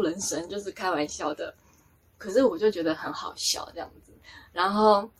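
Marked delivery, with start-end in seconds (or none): none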